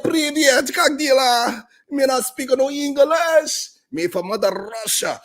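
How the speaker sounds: high, slow mock Russian accent. Russian woman greeting him, hammed-up Russian accent. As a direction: ham up a Russian woman's broken English. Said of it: "She was telling me all this in Russian".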